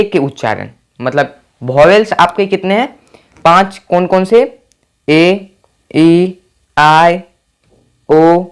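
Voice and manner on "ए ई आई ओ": each vowel drawn out slowly; slow vowel list. Shown as a demonstration. Teacher slowly listing vowels to students. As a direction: spell out the vowels one by one, slowly. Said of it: "ये पांच आपके पास vowels हैं".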